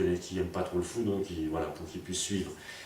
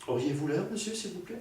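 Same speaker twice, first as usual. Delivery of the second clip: polite lady; 10 semitones higher than usual. Retold or imitated. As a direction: ask sweetly like a refined lady. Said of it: "très poliment d'ailleurs".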